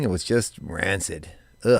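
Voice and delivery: low, soft, slow disgust. Real, not acted. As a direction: sound grossed out. Disgust at butterscotch pudding.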